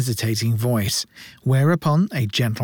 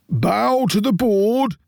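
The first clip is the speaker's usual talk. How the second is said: slow, higher, stern beadle voice; beadle's command; 8 semitones higher, slower than usual. A narrator's reading. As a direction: draw it out like a pompous beadle ordering a child. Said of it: "said Bumble".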